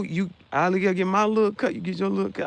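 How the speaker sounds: normal talking voice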